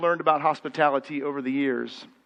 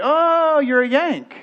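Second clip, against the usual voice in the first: high, louder surprised "oh, you're a Yank"; surprised British clerk; 6 semitones higher, 4 dB louder, slower than usual. Retold or imitated. Clerk spots an American, surprised and higher. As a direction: clerk lights up hearing an American. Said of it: "and then they say".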